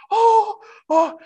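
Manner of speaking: be swept away by the sight; overwhelmed cry. Retold or imitated. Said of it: "he starts to become overwhelmed with it".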